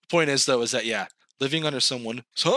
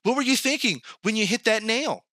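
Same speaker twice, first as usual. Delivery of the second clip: lightly voiced nosy hammer, pitched higher; a prying tool asking questions in a higher voice; chatty hammer; 7 semitones higher than usual. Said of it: "Does the hammer go".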